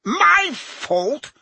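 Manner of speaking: sharp high indignant yelp; stung, defensive retort; affronted. A narrator's reading. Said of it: "colouring up".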